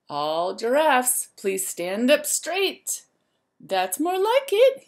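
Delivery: lively, sing-song storybook voice; reading to children. A narrator's reading. Teacher reading a rhyme book to the class, lively and sing-song. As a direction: wake the zoo up like a bright morning call.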